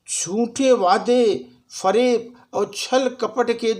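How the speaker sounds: declaimed verse, slow drawn-out phrases with long pauses; stage recital, lines repeated for effect; poem recitation. Acted. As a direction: recite it like a shayari to an audience.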